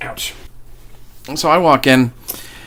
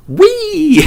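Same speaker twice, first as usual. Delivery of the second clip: a much higher, louder gleeful wee; mock gleeful squeal; playful wee; 5 dB louder than usual. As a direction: toss it out like a kid throwing beads. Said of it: "picked up a bunch of beads and went".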